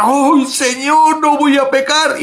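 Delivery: mock a desperate morning promise; imitating his old self begging the Lord; pleading vow. Retold morning vow to God.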